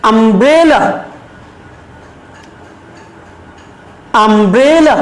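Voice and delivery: umbrella with the middle syllable stretched and rising; stress on BRE. Shown as a demonstration. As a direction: lean on "bre" in umbrella, then fall away. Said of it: "সেই অংশটুকুতে আমরা জোর দিব".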